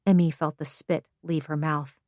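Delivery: plain reading voice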